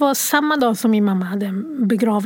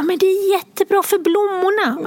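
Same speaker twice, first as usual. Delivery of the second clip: put-on high bright child voice, much higher; cheerful little girl; 8 semitones higher than usual. Mother imitating her upbeat daughter about the rain, high child voice. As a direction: play the five-year-old's sunny reply. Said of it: "Hon bara".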